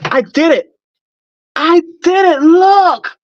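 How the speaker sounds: high, loud squealing joy, close to tears; overjoyed, gasping at the result; real excitement. Real, not acted. Unveiling her finished helmet to viewers, squealing with joy. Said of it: "Can you tell I'm excited?".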